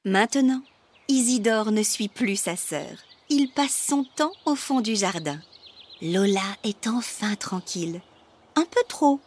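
plain reading voice

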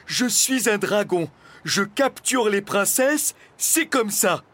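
high, loud, self-important dragon; stubborn dragon. A narrator's reading. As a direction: state it stubbornly, like an obstinate child. (dragon têtu, voix haute et forte)

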